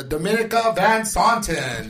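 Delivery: loud, slow announcer call; announcing the guest. Real, not acted. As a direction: call her name out like a host.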